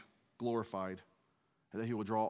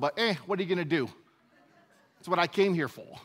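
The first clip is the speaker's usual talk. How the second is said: loud, higher mock New Yorker; terrible New York accent; 6 semitones higher, 6 dB louder than usual. Pastor jokes as New Yorker Jesus, hammed-up New York accent. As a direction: shrug it off in a badly faked New York accent. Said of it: "That's a terrible New York accent".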